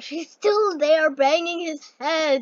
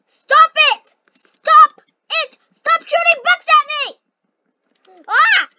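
high, loud panicked yelling; panicked shouting; 12 semitones higher, 9 dB louder than usual. Real, not acted. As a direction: shout at him to stop, panicking. Being shot with books in game.

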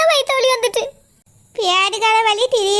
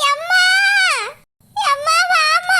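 tearful higher cries for mother; crying out in pain; 5 semitones higher, slower than usual. Acted. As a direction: call for mother through labour pain. Woman in labour calling mother.

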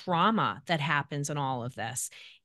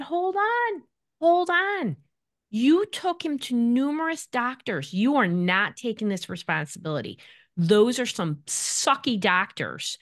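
firm reassurance; 6 semitones higher than usual. Retold or imitated. Firmly reassuring the mother.

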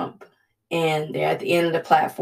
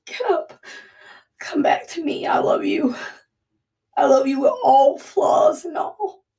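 higher, crying plea rising to a shout; sobbing cry of a loving God calling someone back; God pleading; 7 semitones higher, 4 dB louder than usual. Pretend. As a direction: beg them to come home through tears. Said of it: "He's like".

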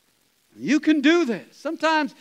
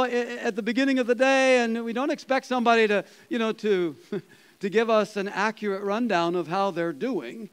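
normal talking voice

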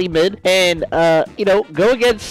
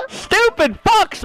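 high slow taunting yell; higher, slower gloating yell; mock shout; 13 semitones higher, much slower than usual. Real, not acted. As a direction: drag out the insult. Yelling at enemies.

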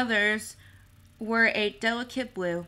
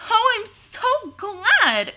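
much higher, slow, loud gush of relief; relieved delight; 13 semitones higher, 7 dB louder, much slower than usual. A narrator's reading. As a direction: let the relief pour out. Relieved girl hears good news.

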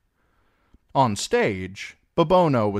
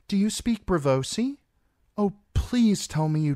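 put-on much higher, breathless little-girl plea; pleading girl voice; 13 semitones higher than usual. Mercy pleading with the guardsmen in a much higher girlish voice. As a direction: play the helpless foreign girl.

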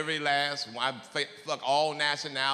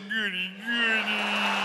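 put-on high slow childlike voice; 5 semitones higher, much slower than usual. Himself mimicking her.

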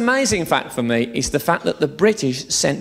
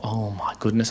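mock awed gasp; 7 semitones lower, 6 dB quieter than usual. Awed thought on seeing woman.

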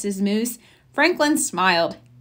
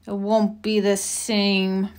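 grumbled; 2 semitones lower, 3 dB quieter, slower than usual. A narrator's reading. Franklin sulking to his mother about the holiday, grumbling. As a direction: sulk it under your breath. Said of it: "Franklin grumbled".

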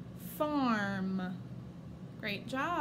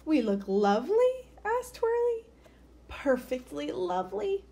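higher bird voices; 9 semitones higher, 3 dB louder than usual. Acted. Birds and Mum exchange.

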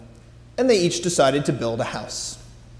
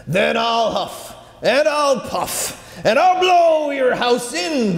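rising higher, slow, building to a roar; werewolf; 8 semitones higher, slower than usual. Acted. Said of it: "the wolf cried".

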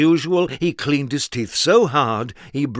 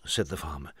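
ordinary delivery

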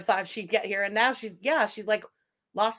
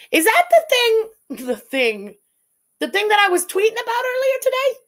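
shrill realization; 10 semitones higher, 8 dB louder than usual. Real, not acted. Realizing what chat means.

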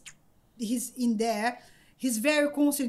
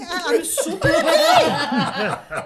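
laughing; 4 semitones higher, 7 dB louder, slower than usual. Retold or imitated. Retelling her giddy flight reply.

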